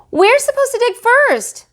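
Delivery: put-on very high, loud child's complaint; protesting kids. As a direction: complain like bossy little kids. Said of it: "said Willie".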